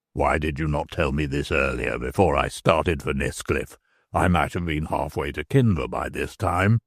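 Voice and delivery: a worried man swearing under his breath; Stracey's voice. Acted. Stracey replies.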